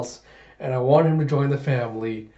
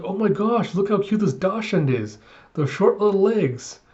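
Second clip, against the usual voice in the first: higher-pitched cooing delight; gushing over cuteness; 6 semitones higher than usual. Retold or imitated. Woman admires a dachshund's short little legs, gushing. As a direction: gush over something adorable.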